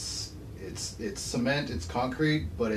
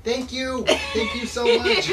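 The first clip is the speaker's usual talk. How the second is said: put-on high, loud gushing child; gushing thanks; 13 semitones higher, 9 dB louder than usual. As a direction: gush your thanks like a kid.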